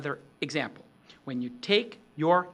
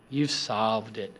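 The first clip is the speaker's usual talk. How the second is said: resolution; 6 semitones lower, slower than usual. Shown as a demonstration. Presenter showing a resolved, much lower and slower voice. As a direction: drop much lower and slow down. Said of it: "there's resolution in your voice".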